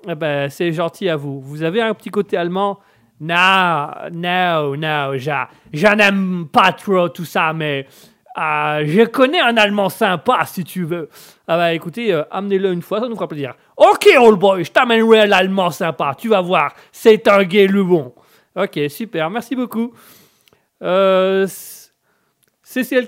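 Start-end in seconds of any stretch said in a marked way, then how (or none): 3.2-11.1 put-on halting American-accented French. Coach denying he has a German side, halting American accent. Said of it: "l'Américain a un petit chat dans la gorge". 13.8-18.1 put-on booming American old boy making a promise; American accent. Coach promising to bring his German friend, loud American accent. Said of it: "l'Américain a un petit chat dans la gorge".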